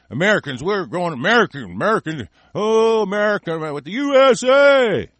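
hammed-up rallying cheer, climbing high and loud; mock patriot. Mocks flag-waving war supporters.

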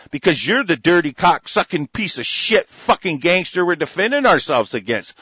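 ordinary delivery

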